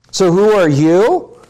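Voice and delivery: lifted questioning you; stress on you. Shown as a demonstration. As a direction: press the you. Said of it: "emphasize the you".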